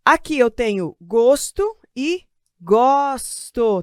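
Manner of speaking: "gosto" first with closed o as in the noun, then "gosto" with open o as in the verb, drawn out slowly. Shown as a demonstration. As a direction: say "gosto e gosto" slowly, closing the first o and opening the second. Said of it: "Tá vendo que tá mudando o meu som?".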